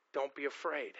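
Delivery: gentle reassurance. Real, not acted. Pastor comforting hurting listeners, softly reassuring. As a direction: speak it softly, like a hand on a shoulder.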